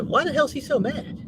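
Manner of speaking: high-pitched mock puzzled outsider; puzzled onlookers. Husband imitating what viewers say about him, high mock-puzzled voice. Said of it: "a lot of people say".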